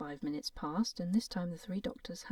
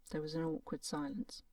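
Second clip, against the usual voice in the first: plain reading voice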